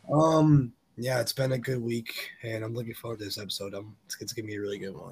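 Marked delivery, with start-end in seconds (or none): none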